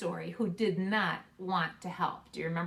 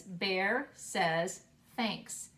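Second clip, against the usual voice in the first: slow title reading; 2 semitones lower, much slower than usual. Shown as a demonstration. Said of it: "This title says".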